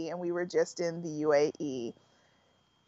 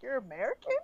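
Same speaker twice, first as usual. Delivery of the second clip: hushed mock disbelief, rising at the end; shocked; 3 semitones higher, 6 dB quieter, faster than usual. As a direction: imitate a softly incredulous question. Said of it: "it's so shocking to them".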